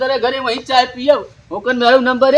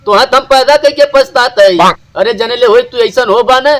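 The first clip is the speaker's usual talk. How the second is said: loud, heated telling-off; angry scolding; 4 dB louder than usual. Acted. Parent berating a grown child by the fire, angry scolding. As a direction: scold with rising volume and force. Acted family quarrel, scolding.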